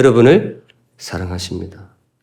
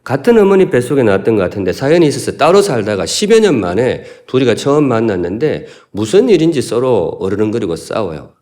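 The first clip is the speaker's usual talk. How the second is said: usual speaking voice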